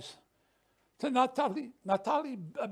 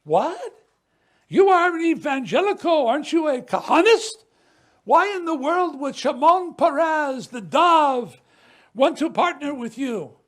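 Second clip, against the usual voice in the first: raised, loud and disbelieving; incredulous challenge; 5 semitones higher, 8 dB louder than usual. Retold or imitated. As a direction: throw the accusing question at him. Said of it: "People say".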